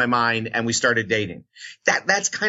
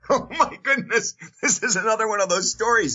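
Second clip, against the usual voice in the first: high, laughing exclamation of mock dismay; 9 semitones higher than usual. Real, not acted. Asked how radio began, amused and laughing. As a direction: talk through laughter, pitched well up.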